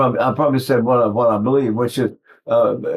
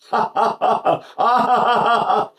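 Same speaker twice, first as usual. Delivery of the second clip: put-on high staccato baby laughter; imitated baby laugh; 8 semitones higher than usual. Shown as a demonstration. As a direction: laugh in rapid pulsed "ha" runs like an infant. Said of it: "when they laugh, they go".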